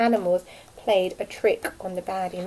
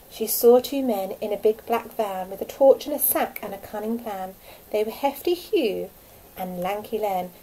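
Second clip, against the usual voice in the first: plain reading voice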